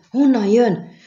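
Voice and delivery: high, loud panicked cry; rikoltás. A narrator's reading. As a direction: shriek the question into the fog. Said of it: "rikoltott fel Boreas Mun". (éles, hangos felrikoltás)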